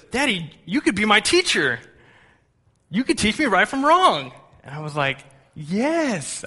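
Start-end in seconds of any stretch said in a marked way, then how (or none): 0.1-4.3 high, loud imitation of a thrilled child; excited little boy. Young son realizing dad can teach him, imitated high excitement. 5.5-6.4 high, drawn-out retold yes; delighted agreement. Dad confirming his son's realization, high and delighted.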